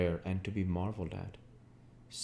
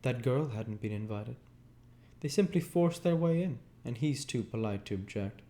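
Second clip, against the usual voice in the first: Daisy gossiping, a touch higher; Daisy's voice; 5 semitones higher than usual. A narrator's reading. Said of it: "she said, suddenly".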